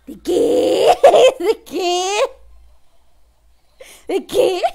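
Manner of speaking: very loud screech through laughter; shocked laughing shout. Real, not acted. Streamer reading an outrageous viewer message, shocked laughing shout. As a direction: bellow the question while cracking up.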